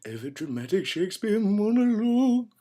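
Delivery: high, loud theatrical flourish; overdramatic declaiming. Pretend. Instructor teaching overdramatic monologues, declaiming grandly. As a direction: ham up the title, soaring on the last word. Hams up the show's subject.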